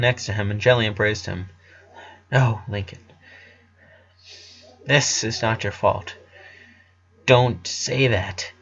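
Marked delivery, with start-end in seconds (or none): 2.3-8.5 gentle comfort. Acted. Sister gently comforting her brother.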